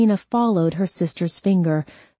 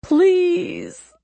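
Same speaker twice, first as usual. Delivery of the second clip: high-pitched, wailing plea; desperate begging; 13 semitones higher, 3 dB louder than usual. A narrator's reading. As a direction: plead in a high, desperate cry. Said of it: "Wright begged".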